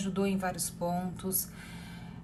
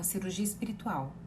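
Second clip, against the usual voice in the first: hushed heard voice; 8 dB quieter than usual. Retold or imitated. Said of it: "Aí eu escutei assim".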